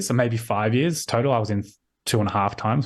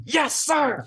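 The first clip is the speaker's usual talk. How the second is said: much higher keen yes sir; eager American recruit. Retold or imitated. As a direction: snap to attention like a zealous cadet. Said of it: "this one voice going".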